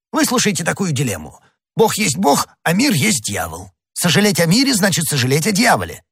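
high-pitched, insistent disputing priest; indignant arguing Jesuit. A narrator's reading. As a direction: press the argument in a high, sing-song voice.

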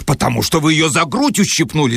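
shout. Acted. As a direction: call it out in accusation. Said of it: "закричал Барменталь". (Борменталь кричит, выше)

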